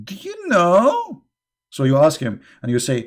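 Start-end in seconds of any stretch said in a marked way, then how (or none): 0.1-1.2 high, slow, drawn-out teasing question; mock show-off. Mocking the prophet showing off to followers, high and slow. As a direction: ham up a know-it-all's riddle. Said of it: "He wanna show off that he knew".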